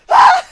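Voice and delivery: high, loud laughing shriek; sudden shrieked "ah" cracking into laughter; mock scream. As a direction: let out a hammed-up creature scream. Mimics rat crawling upstairs.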